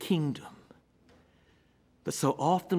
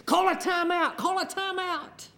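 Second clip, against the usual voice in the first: high-pitched armchair-coach holler; mock yell; 13 semitones higher than usual. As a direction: imitate yelling helplessly at the TV. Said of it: "I've yelled at the television".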